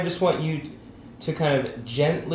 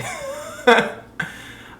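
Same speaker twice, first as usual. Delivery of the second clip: unvoiced laugh, a little louder; breathy laugh; 3 dB louder than usual. Real, not acted. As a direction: let out a short laugh. Laughs at own joke.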